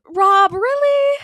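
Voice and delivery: high, incredulous, drawn-out "Rob, really?"; slow and high in disbelief; exasperated disbelief. Real, not acted. To the co-host, appalled at Rob's vote: high, slow disbelief.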